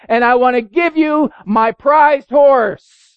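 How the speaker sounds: high, loud, animated preacher storytelling; preaching at full force. Acted. Preacher retelling the farmer's gift, high and loud. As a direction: build the excitement as he tells the farmer's gift.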